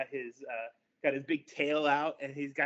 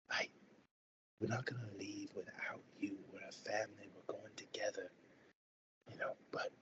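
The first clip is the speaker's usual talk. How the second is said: soft pleading whisper; whispered family promise; 4 semitones lower, 11 dB quieter than usual. Acted. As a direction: insist quietly, voice barely there. Spouse refuses to leave, whispering.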